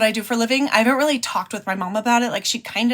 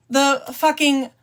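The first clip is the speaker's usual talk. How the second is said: ordinary talk, nothing marked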